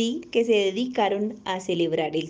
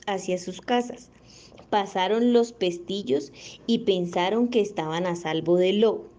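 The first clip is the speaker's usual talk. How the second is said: plain reading voice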